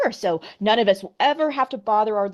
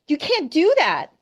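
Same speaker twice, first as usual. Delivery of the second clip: high, louder wailing protest; 10 semitones higher, 4 dB louder than usual. Acted. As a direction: wail it out like an upset guest. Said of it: "wailed Missus Bowles".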